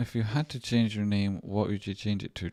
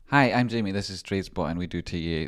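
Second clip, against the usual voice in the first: presenter intro; 6 dB louder than usual. Acted. Greeting viewers at the start of the clip, presenter style. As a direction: open the clip like a host. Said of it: "do the clip".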